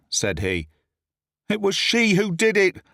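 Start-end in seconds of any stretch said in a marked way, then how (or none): 1.5-2.8 high urgent protest; desperate protest. A narrator's reading. The accused man points at the lady, high desperate cry. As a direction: blurt it out to save yourself. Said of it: "I cried".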